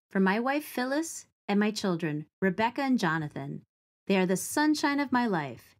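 ordinary delivery

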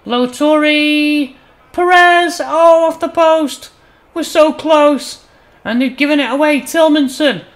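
loud, high, stretched-out names in mounting excitement; excited play-by-play. Real, not acted. Commentator watching a shot hit the post, loud and agitated. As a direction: cry out as the chance slips by.

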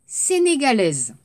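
ordinary talk, nothing marked

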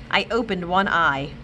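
usual speaking voice

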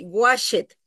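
"washed" as two syllables, wash-et; wrong "washed" with E sounded. Shown as a demonstration. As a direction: say the -ed of "washed" as a separate "et". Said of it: "no lo pronuncio de esta manera".

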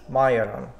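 majoran said "MY-oh-ran", j as y; Slovak pronunciation. Shown as a demonstration. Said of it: "That's how I pronounce it in Slovakia".

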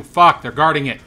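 loud frustrated outburst on Fuck; frustrated curse. Real, not acted. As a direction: curse it out in annoyance. Enemies guard the house.

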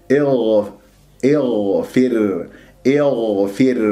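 اغفر slow, sound flowing through the gh; voiced ghayn. Shown as a demonstration. Teacher modelling the ghayn for students, slow and voiced. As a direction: hold the gh voiced, with no kh colour. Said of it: "بهذه الطريقة".

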